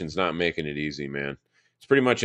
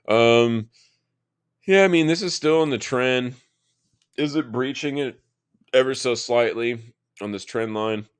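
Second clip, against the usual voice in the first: talking through hiccups; words jolted by hiccups; 6 semitones higher, 4 dB louder than usual. Real, not acted. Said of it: "I got the hiccups".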